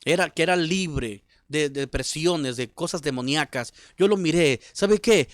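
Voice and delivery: loud, driving sermon delivery; impassioned preaching. Real, not acted. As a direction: preach it out with rising force. Preaches at full force.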